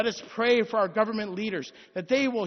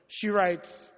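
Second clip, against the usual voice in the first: usual speaking voice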